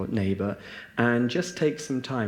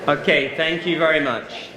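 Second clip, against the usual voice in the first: louder and higher, called out over the chatter; calling the room back; 6 semitones higher, 7 dB louder than usual. Real, not acted. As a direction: call out to pull everyone back. Calling the room back after discussion.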